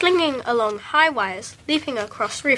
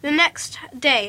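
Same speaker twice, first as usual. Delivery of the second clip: ordinary delivery, nothing marked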